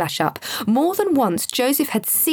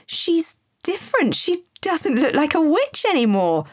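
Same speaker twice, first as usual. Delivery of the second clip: high-pitched, excited disbelief; astonished, pitch lifted throughout; amazed exclamation; 8 semitones higher than usual. A narrator's reading. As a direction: marvel at it in a high child's voice. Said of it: "exclaimed to Sam in complete amazement".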